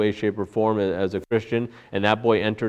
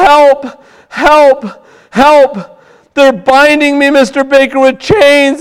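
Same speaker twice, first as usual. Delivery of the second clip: shrieking for help, much higher and louder; terrified screaming; 14 dB louder, much slower than usual. Acted. Dying boy shrieking for Mister Baker to save him, terrified high screams. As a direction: scream in terror as if chains are closing in. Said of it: "he cried at the top of his voice in the wildest of tears".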